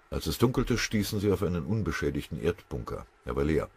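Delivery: plain reading voice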